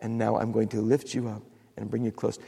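gentle, hushed reassurance from God; tender God. Pretend. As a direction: speak like a father holding a child. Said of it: "Then God takes us up in His arms and He says".

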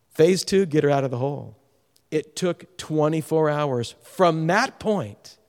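ordinary talk

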